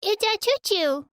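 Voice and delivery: put-on a high toddler voice showing off his toy; excited toddler. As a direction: squeal it out like a thrilled small child.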